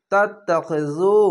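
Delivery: "তাত্তাখিজু" slowly, doubled ত and long final জু; one-alif stretch. Shown as a demonstration. Teacher reciting for learners, "তাত্তাখিজু" slow, drawn out on জু. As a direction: hold the last vowel of "তাত্তাখিজু". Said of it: "এক আলিফ টেনে পড়বেন".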